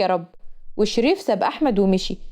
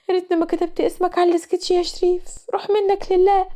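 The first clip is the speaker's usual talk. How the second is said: comic despair; 6 semitones higher than usual. Acted. Rana curses Sherif in despair.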